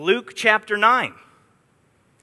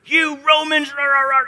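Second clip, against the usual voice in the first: wild-eyed rebel; 7 semitones higher than usual. Retold or imitated. Preacher mimics a revolutionary ranting at Romans, mock shouting. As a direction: ham up a fiery revolutionary shouting at Rome. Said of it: "These wild-eyed leaders".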